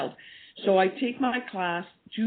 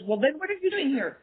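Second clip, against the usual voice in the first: higher, mock-indignant challenge; indignant boss; 8 semitones higher than usual. Retelling Doctor Galdikas telling her to leave, mock indignant. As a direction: retell her sharp dismissal, pitched up. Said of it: "She says".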